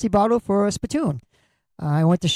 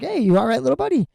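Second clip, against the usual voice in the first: gentle concern; 6 semitones higher, faster than usual. Retold or imitated. Cashier to a bawling little boy at Christmas, gentle concern. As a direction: lilt upward kindly, as if to a small child. Comforting a bawling child at register.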